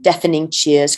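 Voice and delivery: usual speaking voice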